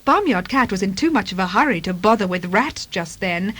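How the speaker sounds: plain reading voice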